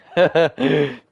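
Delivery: sudden loud burst of laughter. Real, not acted. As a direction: crack up hard at your own joke. Laughing at his Batman joke.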